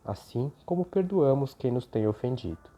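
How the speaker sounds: soft, devout prayer voice. Acted. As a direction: pray gently and reverently. Opening prayer over music.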